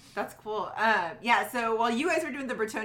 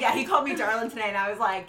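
ordinary talk

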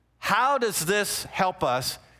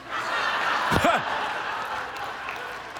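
laughing; 7 semitones higher than usual. Real, not acted. Laughing at his own punchline.

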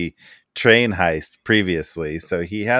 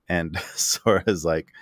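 normal talking voice

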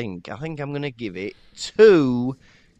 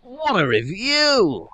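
impressed exclamation; 10 semitones higher, 5 dB louder, slower than usual. Real, not acted. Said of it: "reacting to review".

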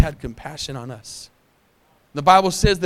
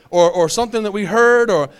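usual speaking voice

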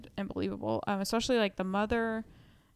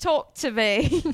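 put-on "Talk to Me" drawn out slow and loud in an Australian accent; hammed-up Australian "Talk to Me"; 5 semitones higher, 11 dB louder, slower than usual. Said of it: "since I'm talking Australian accent".

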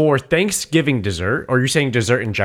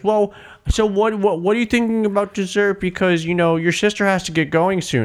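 mom voice; 8 semitones higher than usual. Retold or imitated. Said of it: "We're just".

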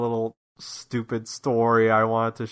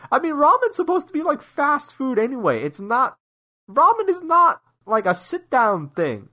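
much higher, fast indignant rant; high-pitched exasperated rant; 13 semitones higher than usual. Real, not acted. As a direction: pitch it up and vent. Ranting about ramen etiquette.

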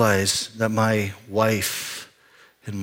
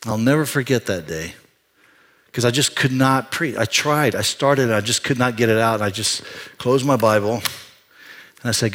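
ordinary talk

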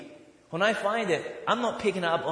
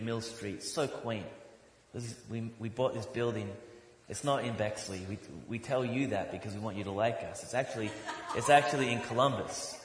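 normal talking voice

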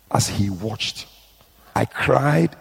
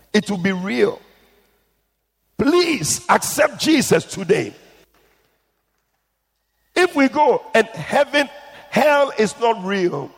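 passionate preaching; 8 semitones higher than usual. Real, not acted. Preacher urging listeners to accept Jesus, high. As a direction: plead with the crowd.

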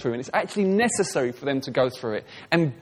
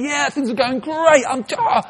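higher, slow, carefree sing-song; breezy cheerful person; 9 semitones higher than usual. Retold or imitated. People telling the preacher things are going great, lightly mocked. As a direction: mimic someone who thinks all is fine. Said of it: "People say, they'll say".

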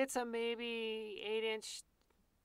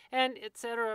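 usual speaking voice

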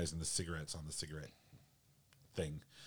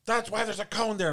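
high, loud mock attendant retort; snippy gas station worker, hammed-up high and loud; 10 dB louder than usual. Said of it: "And he's like".

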